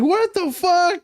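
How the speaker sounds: very high startled outcry; mock panic. Retelling the passenger's panicked reaction.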